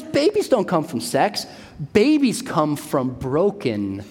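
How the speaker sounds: usual speaking voice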